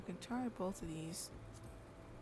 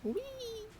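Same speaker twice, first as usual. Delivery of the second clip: excited whee. Real, not acted. Delight while jumping.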